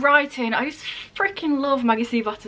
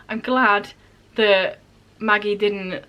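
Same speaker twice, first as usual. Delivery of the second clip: usual speaking voice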